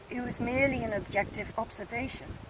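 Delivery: ordinary delivery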